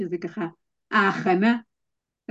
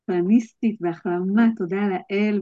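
ordinary talk, nothing marked

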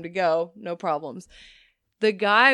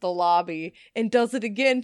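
ordinary talk

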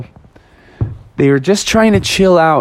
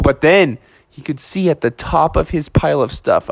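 usual speaking voice

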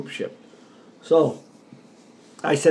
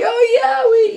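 called back; 8 dB louder, slower than usual. Retold or imitated.

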